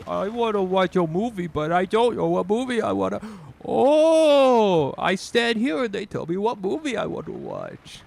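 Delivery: high, loud cartoon voice; goofy game character voice. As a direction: play the silly shopper character. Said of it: "as to be like".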